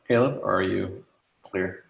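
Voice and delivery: ordinary talk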